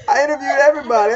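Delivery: laughing repeat. Real, not acted. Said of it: "laughing while telling".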